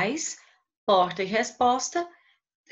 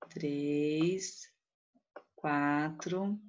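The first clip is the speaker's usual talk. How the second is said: soft, low counting with pauses; counting syllables; 4 semitones lower, 7 dB quieter, much slower than usual. Shown as a demonstration. Teacher counting the poetic syllables aloud with the class. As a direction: tick off each syllable quietly. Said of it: "Vamos contar?".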